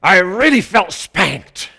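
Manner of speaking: a hint of a pleased lady after church. Church lady praising the pastor's sermon, lightly imitated. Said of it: "She said".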